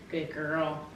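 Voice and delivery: quietly praising the dog; soft praise. Real, not acted. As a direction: praise her gently under your breath. Praising dog after trick.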